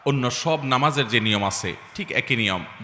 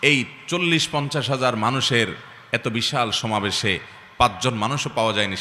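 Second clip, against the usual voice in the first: usual speaking voice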